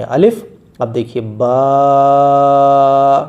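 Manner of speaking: each letter name stretched long on one breath; Arabic letter names chanted slowly, one per breath; drawn-out letter names. Shown as a demonstration. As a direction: hold every letter name as long as your breath lasts. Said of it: "اسی لیے ہم اس کو کھینچ کھینچ کر پڑھیں گے".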